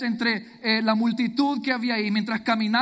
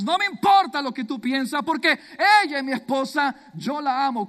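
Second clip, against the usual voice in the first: louder and higher, firm and proud, "importa" rising; defiant declaration; 5 semitones higher, 6 dB louder than usual. Acted. Hosea loudly defending his love against the mocking crowd. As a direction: fling it back at the crowd.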